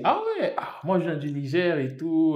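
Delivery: high, loud imitated delight; excited recognition. Student from Niger meeting a fellow African, high and excited. Acting friend's delighted reply.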